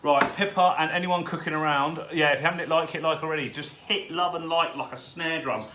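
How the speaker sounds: ordinary delivery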